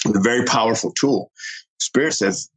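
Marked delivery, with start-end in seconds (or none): none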